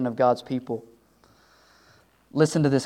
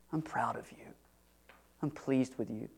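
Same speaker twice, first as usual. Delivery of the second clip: much softer, barely above a whisper; soft tender voice; 3 semitones lower, 14 dB quieter than usual. Acted. God tells his servant he is proud, soft and tender. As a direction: murmur it close and gentle.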